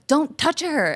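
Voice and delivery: retold yell. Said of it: "was screaming and yelling at him".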